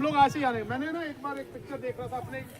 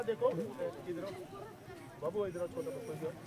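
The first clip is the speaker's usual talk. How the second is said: put-on coaxing child softly; 6 semitones lower, 10 dB quieter than usual. Coaxing a small child over.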